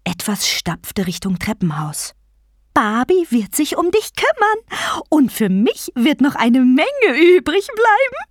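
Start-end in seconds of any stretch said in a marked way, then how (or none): 2.7-8.3 taunting vulture goddess. Acted. Vulture goddess promising patience to her prey, taunting. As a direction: gloat with big pitch swoops, stretching words.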